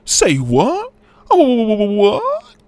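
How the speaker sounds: very high, exaggerated disbelief; hammed-up high-pitched shock; mock surprise. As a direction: squeak out a fake shocked question. Mock shock at his own fact.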